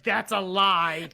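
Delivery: slow, lightly voiced wife; a hint of a stern wife; mocking wife. Husband mimics wife calling out a lie. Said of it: "I don't sound like that".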